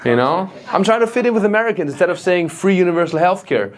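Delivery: ordinary delivery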